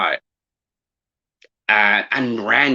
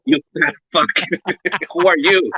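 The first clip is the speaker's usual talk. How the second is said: hammed-up mom jabbing at him; mock mom insult; 5 semitones higher than usual. Mom pretending not to know her fat son, mocking.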